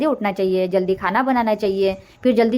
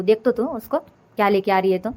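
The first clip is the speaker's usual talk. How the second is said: ordinary delivery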